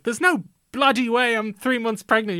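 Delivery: mock British line. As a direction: ham up a forced British phrase. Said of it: "she has to say something really British".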